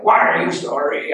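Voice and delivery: loud, hammed-up tearful question; retold bawling. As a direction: imitate yourself bawling it out loud. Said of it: "I start bawling 'cause I'm sorry".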